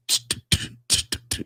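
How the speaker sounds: voiceless ticking t-t-t beat; imitated beat. Cop mimics the rapper's beat machine with ticking sounds. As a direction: tap out the beat with your tongue. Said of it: "you know, do".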